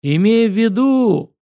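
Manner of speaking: high, loud, slow interruption; overbearing director cutting in. Acted. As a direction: talk over the other person, stretching the words. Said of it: "прервал меня Карасиков".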